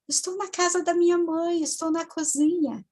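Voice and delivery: put-on high, small child answering; reply as a little girl would; imitated small child's voice; childlike voice. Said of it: "de criança, uma voz bem infantil".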